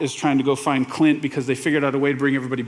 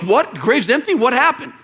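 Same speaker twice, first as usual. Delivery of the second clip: higher, indignant questioning; mild anger; 6 semitones higher than usual. Acted. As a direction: act out startled, angry disbelief. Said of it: "first response would be anger".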